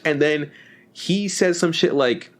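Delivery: ordinary delivery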